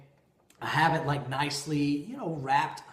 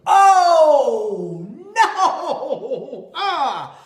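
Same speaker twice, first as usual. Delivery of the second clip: loud high oh no with a laugh, then ah; the dawning horror of your blunder; laughing groan; 12 semitones higher, 13 dB louder, much slower than usual. Real, not acted. Husband realizing he bought the wrong watch, laughing groan. Comic realization of his mistake.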